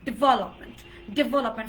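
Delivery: development as di-VEL-up-ment, stress on the second syllable; correct pronunciation. Shown as a demonstration. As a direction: punch the second syllable of development, dropping low after. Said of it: "but it's". (development শুদ্ধ উচ্চারণে, দ্বিতীয় অংশে জোর)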